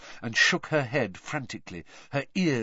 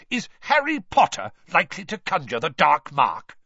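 raised, louder indignant question; angry Mister Weasley; 7 semitones higher, 6 dB louder than usual. A narrator's reading. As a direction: demand it angrily, pitched up. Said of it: "very angrily".

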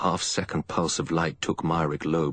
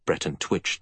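plain reading voice

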